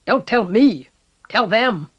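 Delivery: loud, sharp snap; snapped. A narrator's reading. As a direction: snap back at him. Levick snapping.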